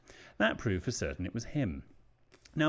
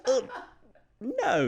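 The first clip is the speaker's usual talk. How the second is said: high, loud, incredulous no; 5 dB louder than usual. Real, not acted. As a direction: shoot the idea down in disbelief. Refusing bog-cleaning revenge idea.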